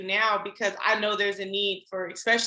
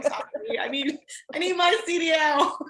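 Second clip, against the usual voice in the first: laughing apology; 7 semitones higher than usual. Retold or imitated.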